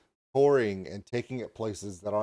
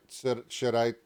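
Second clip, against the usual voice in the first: ordinary talk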